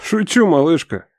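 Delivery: slow teasing reassurance; joking, letting her off the hook; playful. A narrator's reading. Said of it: "Смеётся, дурачится". (шутливо, с улыбкой)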